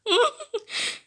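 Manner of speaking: high-pitched giggling burst; laughing. Acted. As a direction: cackle high and quick. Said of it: "who's laughing in my ears".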